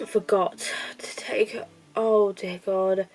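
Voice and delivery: usual speaking voice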